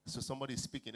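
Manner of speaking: ordinary delivery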